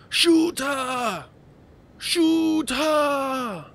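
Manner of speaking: high, loud yell to fire; excited shout. Real, not acted. Streamer yells at the game to fire, high and loud. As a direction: bellow the order. Urging the shot.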